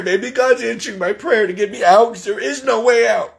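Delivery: crying through the words, worn out and desperate; real crying. Real, not acted. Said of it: "I'm sorry I keep crying".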